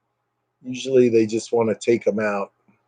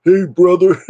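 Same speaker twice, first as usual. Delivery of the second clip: higher, louder wrestler greeting; Hulk Hogan impression; 7 semitones higher, 6 dB louder than usual. Streamer imitates Hulk Hogan's catchphrase, loud and higher. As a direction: bellow it like a wrestling promo. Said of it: "the Hulk Hogan, he always said".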